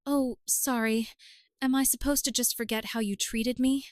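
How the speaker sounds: usual speaking voice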